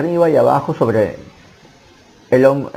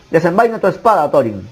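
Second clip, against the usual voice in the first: higher-pitched, restrained cry of command; wizard's urgent command; 4 semitones higher than usual. A narrator's reading. Wizard ordering Thorin to fight, urgent mild shout. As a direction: call the order out without a full shout. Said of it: "gritó el mago".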